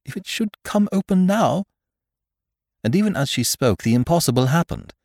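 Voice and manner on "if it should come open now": high-pitched fearful woman; frightened, raised pitch; gasped. Acted. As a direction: voice the dread in a high gasp. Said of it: "she gasped".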